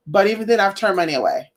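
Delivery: ordinary talk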